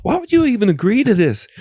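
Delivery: incredulous inner voice mocking himself. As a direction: let the doubting voice in your head laugh at you. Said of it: "this voice is like".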